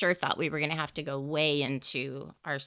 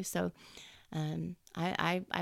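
ordinary talk

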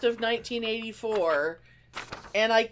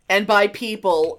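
usual speaking voice

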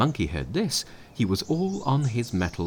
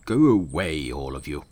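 louder dismissive shout; curt angry shout; 4 semitones lower, 5 dB louder than usual. A narrator's reading. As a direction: send the whole crowd away. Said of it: "the patriarch shouted".

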